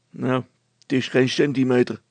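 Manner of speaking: loud but glum; gloomy. A narrator's reading. Brilstra watches the bike stay grounded, gloomily. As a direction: call out with little hope. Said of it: "riep Brilstra op sombere toon".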